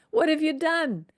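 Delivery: high, mock-frightened cry at Moses; panicked Israelites. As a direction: pitch it up like a scared crowd accusing Moses. Israelites yelling at Moses retold.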